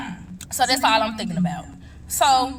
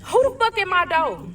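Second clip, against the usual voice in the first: higher and louder, furious at the knocking; pissed-off yell; 9 semitones higher, 3 dB louder than usual. Retold or imitated. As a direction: snap the curse out, fed up and loud. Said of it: "So I'm pissed".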